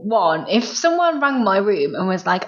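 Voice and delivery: normal talking voice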